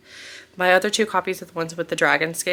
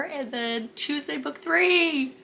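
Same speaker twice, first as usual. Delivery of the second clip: excited; 10 semitones higher, slower than usual. Real, not acted. Teasing the next book release.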